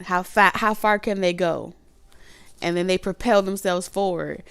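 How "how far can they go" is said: ordinary talk, nothing marked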